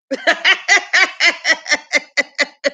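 long high laugh; real laugh. Real, not acted. After telling the boss story, laughing long. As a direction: laugh at your own story.

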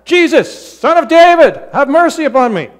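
high, full-force yell. Acted. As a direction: belt it out over the shushing.

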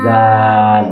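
loud জাল, tongue between teeth, long aa; pronunciation demo. Shown as a demonstration. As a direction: project জাল for the pupil, vowel held. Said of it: "জিহ্বা রেখে তারপর উচ্চারণ".

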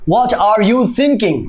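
"what are you thinking?" slow and separate; slow full form. Shown as a demonstration. Teacher shows the long form first. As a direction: say every word apart slowly. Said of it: "যেমন".

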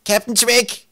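high, loud alarmed yell; urgent high shout. A narrator's reading. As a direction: scream for the captain. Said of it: "schrie Zacke".

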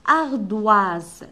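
ardoise said loud and slow; model word. Shown as a demonstration. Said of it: "شنو نسمع في ardoise?". (كلمة ardoise بصوت عالٍ وواضح)

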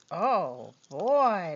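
ordinary talk

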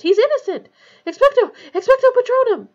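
high, very loud frantic cries; desperate shouting. A narrator's reading. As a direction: shout in defence, then hurl the spell. Harry desperately defending Sirius from Dementors.

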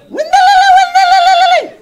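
same high shrieked gibberish back; imitated shouting. Woman's friend answering the same way, mock high shouts. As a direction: holler it back on one high note. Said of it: "היא עונה אותו דבר".

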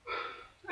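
short breathy sigh. Real, not acted. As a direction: let out a sigh before giving in. Sighs before giving up whispering.

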